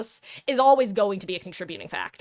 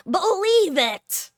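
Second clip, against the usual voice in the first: much higher, loud and slow catchphrase; Naruto impression; 11 semitones higher, 5 dB louder, much slower than usual. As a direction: shout it like Naruto. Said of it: "try to do an impression of his voice".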